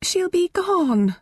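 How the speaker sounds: high, slow pleading Mum; begging. Acted. Mum begging Helen for the binoculars, high slow plea. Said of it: "Mum begged".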